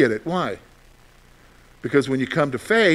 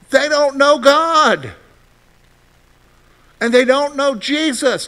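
slow, higher and louder, each word weighed; emphatic preaching; 7 semitones higher, 4 dB louder than usual. Real, not acted. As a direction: drive the truth home slowly. Preacher hammering the key point.

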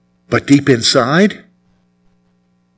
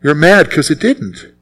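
normal talking voice

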